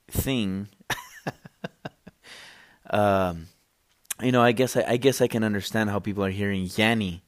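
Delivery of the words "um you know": normal talking voice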